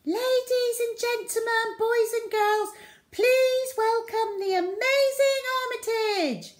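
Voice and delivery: put-on high, loud little-girl ringmaster; showman announcement. A narrator's reading. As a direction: present the star like a ringmaster. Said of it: "said the little ginger girl".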